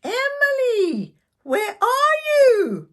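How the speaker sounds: worried sister calling for Emily. Acted. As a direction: call out into the unknown. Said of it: "Mel called out".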